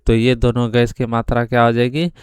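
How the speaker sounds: ordinary talk, nothing marked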